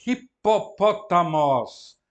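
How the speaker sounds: loud, slow "hipopótamos", each syllable separate. Shown as a demonstration. Teacher joining two Greek words, "hipopótamos" slowly syllabified. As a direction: spell out "hipopótamos" in deliberate beats.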